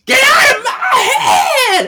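very high, drawn-out loud scream; hammed-up scream. Host voicing Prue driving someone from her head, screamed. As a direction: scream it slowly at full mock fury.

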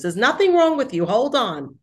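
bursting in to reassure him, then cutting herself off; emphatic reassurance. Retold or imitated. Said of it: "She goes".

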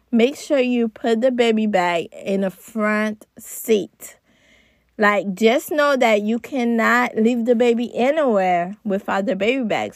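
usual speaking voice

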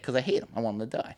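ordinary talk, nothing marked

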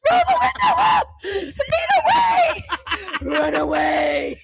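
high laughing shout, slowing; panicked shouting. Retold or imitated. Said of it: "I was like".

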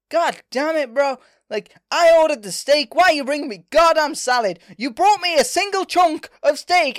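loud high complaining rant; mock outrage. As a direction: rant like an angry customer. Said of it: "It's like".

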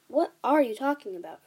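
loud question, stress on ARE; bewildered, emphatic question; baffled. A narrator's reading. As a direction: demand an explanation. Sophie baffled by his outburst.

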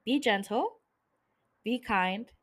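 ordinary delivery

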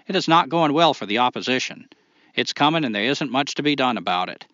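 reading the letter in a hammed-up Maine accent. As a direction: put on a thick Maine accent for the listener letter. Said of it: "read Tim's write-in with a Maine accent".

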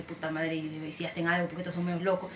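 low, hushed worrying to herself; soft, uneasy inner monologue; retold worry. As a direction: swear under your breath, imitating a scared moment. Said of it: "sí me asusté".

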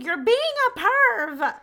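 high, loud call-out of a creep; imitating the friend snapping at him; mock accusing yell. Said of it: "she's like".

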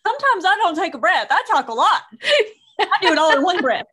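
high, loud, fast protest in one breath; exasperated retort. Retold or imitated. Protesting to her husband about commas, breathless exasperation. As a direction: blurt it all out without stopping.

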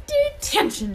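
sudden high, loud shout; stern teacher shouting at a pupil. A narrator's reading. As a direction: bark the punishment at him. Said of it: "she shouted".

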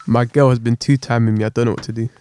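ordinary talk

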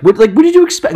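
high, loud and scornful; loud mock disbelief; incredulous outburst. Real, not acted. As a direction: throw the question at her, outraged. Mocking her firing, outraged.

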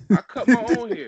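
high, loud, laughing admission; real laugh through the words. Real, not acted. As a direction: burst out laughing as you say it. Laughing confession about home haircuts.

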